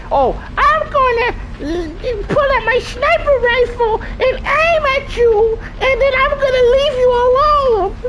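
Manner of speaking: very high squeaky mocking puppet voice; mock him in a shrill cartoon falsetto; Elmo. Streamer mocking the game's zesty dude, Elmo voice. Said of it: "like Elmo".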